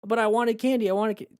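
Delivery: put-on very high, protesting kid cut off mid-word; whining child. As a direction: whine back at the parent like a spoiled kid. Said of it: "the kid wanting candy".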